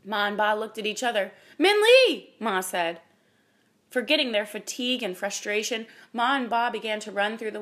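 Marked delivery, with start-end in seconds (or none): 1.6-2.3 high, loud urgent call; sudden hopeful cry of a name. A narrator's reading. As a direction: shout for a missing child. Mother calls out for missing daughter.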